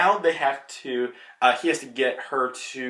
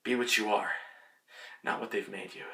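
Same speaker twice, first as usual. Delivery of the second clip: dying words; 5 semitones lower, 11 dB quieter than usual. Retold or imitated. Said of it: "he looks at X twenty-three and he goes".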